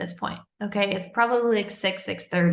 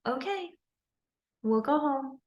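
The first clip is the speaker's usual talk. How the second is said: higher, hushed, reluctant giving-in; annoyed, embarrassed mother; 5 semitones higher, 4 dB quieter than usual. Retold or imitated. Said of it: "annoyed, embarrassed".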